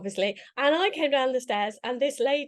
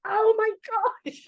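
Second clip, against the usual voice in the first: amazed realisation; 10 semitones higher than usual. Retold or imitated. Reacting to a friend's message, amazed. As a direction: exclaim it as the penny drops.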